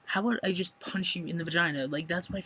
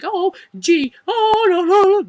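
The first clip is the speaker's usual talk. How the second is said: squealed high and loud; a hammed-up squeal, far higher and louder; mock panic; 13 dB louder, slower than usual. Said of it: "I can't just be like".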